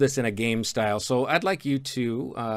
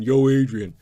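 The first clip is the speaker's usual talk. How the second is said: loud Rocky call, yo Adrian; hammed-up boxer calling out; Rocky impression; 9 dB louder than usual. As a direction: shout it like Rocky Balboa. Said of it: "That's Paulie ... Rocky".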